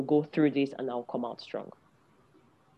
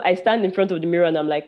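ordinary talk

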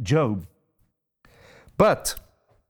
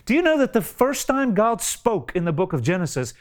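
normal talking voice

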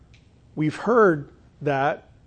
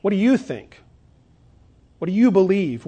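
"you" lifted, louder questions; emphatic you; 3 semitones higher, 5 dB louder than usual. Shown as a demonstration. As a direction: hit "you" in each question. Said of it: "the you there is emphatic".

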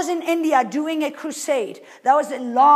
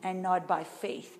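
gentle inner voice; 5 semitones lower, 7 dB quieter than usual. Retold or imitated. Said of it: "the Holy Spirit says".

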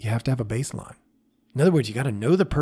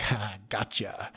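smirking gotcha; 4 semitones lower, 8 dB quieter, slower than usual. Retold or imitated. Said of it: "little smirk of like".

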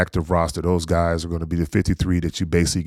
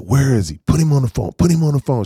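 higher, louder, pushing to get him on; gleeful demand; 7 semitones higher, 4 dB louder than usual. Retold or imitated. Nephew to auntie, demanding his uncle, excited. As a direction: put him on, put him on, playful and insistent.

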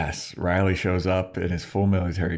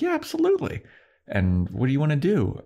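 much higher, chirpy agreement; eager squeak; 9 semitones higher than usual. Retold or imitated. Tara eagerly agreeing to Willow's spell, much higher. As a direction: jump up into a bright squeak of yes.

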